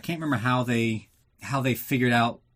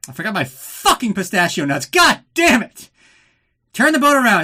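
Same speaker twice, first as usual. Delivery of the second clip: loud, high, hammed-up rant about forgotten pistachio nuts; mock angry rant; 9 semitones higher, 8 dB louder than usual. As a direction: shout it like a spoiled tantrum over snacks. Columbo ranting about forgotten nuts.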